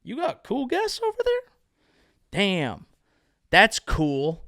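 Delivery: put-on high, impressed teen voice; naive kid. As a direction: gush like an easily wowed kid. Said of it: "I might be going".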